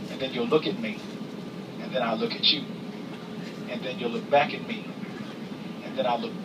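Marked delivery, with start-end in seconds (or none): none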